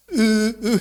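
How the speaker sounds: "Uh, uh" as a failed u, lips left unrounded; dhammah without rounding. Shown as a demonstration. Teacher showing dhammah fails without ismam, unrounded "Uh, uh". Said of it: "Nggak bisa kita dhammah nggak ismam".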